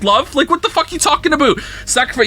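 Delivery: ordinary talk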